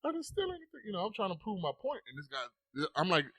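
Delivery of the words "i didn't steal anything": put-on high-pitched childish protest; bothered little kid. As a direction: imitate an indignant child, pitched way up. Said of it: "I'm really bothered by it".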